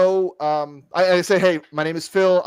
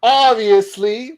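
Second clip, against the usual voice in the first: drawn out obviously; 5 semitones higher, 7 dB louder, much slower than usual. Acted. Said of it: "repeated word play".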